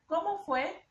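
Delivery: high "cómo" stressed on the first O; question with accent. Shown as a demonstration. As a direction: ask it, lifting "cómo" high. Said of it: "si yo le pongo el acento en la primera O".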